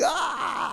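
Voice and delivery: high, held cry of hilarity; long high-pitched mock howl; imitated hilarity. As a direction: mimic someone cracking up with a high "ah". Imitates Terry's hilarity at the joke.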